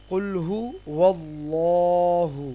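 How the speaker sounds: কুল হুয়াল্লাহু very slow, long dark "lloo" before "hu"; heavy Lam pronunciation. Shown as a demonstration. Teacher models the pronunciation, কুল হুয়াল্লাহু slow with heavy Lam. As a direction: stretch কুল হুয়াল্লাহু with a thick rounded L sound. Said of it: "উচ্চারণটা কি হবে".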